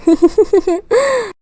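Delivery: a high amused laugh; a quick giggle at her own joke; short laugh. Acted. Sister-in-law laughs at a joke.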